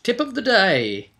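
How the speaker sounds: high, slow, grandly announced hint, lightly voiced; hammed-up game announcer reading the tip; loading screen announcer. Mock game announcer reading a loading screen tip. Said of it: "that's a Dragon Age loading screen".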